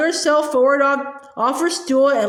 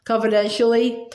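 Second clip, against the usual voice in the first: usual speaking voice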